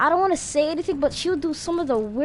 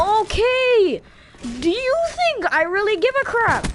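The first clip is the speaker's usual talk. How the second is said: sarcastic retort; 8 semitones higher than usual. Retold or imitated. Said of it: "I'm like".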